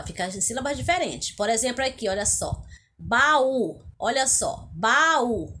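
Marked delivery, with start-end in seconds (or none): 3.0-3.8 Baú with the Ú split from the A and pushed louder; stressed, separated U. Shown as a demonstration. Teacher shows pupils the hiatus, Baú with a loud separate Ú. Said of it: "o U está separado do A". 4.7-5.6 Baú with the Ú split from the A and pushed louder; stressed, separated U. Shown as a demonstration. Teacher shows pupils the hiatus, Baú with a loud separate Ú. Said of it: "o U está separado do A".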